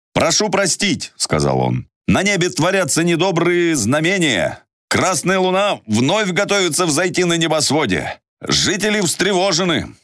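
high, uneasy guard; a worried watchman warning. Acted. Guard voiced in story.

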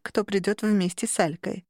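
plain reading voice

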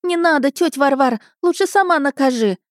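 put-on high, pleading childish voice; put-on panicked lad; squealing boy. A narrator's reading. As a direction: beg squealingly for mercy. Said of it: "верещал тот".

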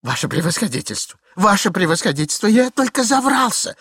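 dumbfounded, rising in pitch; flustered cry. A narrator's reading. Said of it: "кричал оторопевший Иван Андреевич". (оторопелый выкрик)

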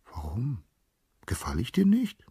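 soft, high, breathy plea; small, lovestruck question; hurt pleading. A narrator's reading. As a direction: ask meekly, wounded. Rejected nymph pleads softly.

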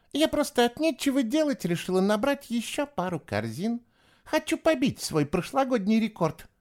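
put-on high-pitched, chatty, dismissive girl's voice; scornful girl. A narrator's reading. Eva brushing off the new boy, high and cocky. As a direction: brag in a high girlish voice.

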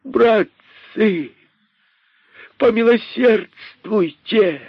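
высоко, медленно, умоляюще кричит; взмолись во весь голос; мольба криком. Acted. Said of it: "закричал находчивый". (находчивый высоко умоляет братцев-кроликов)

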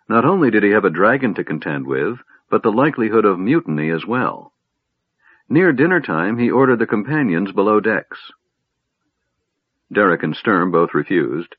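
usual speaking voice

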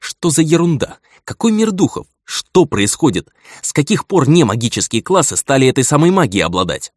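very high alarmed questions; frantic inner outcry; panicked disbelief. Acted. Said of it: "Мысленно кричал я".

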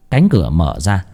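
usual speaking voice

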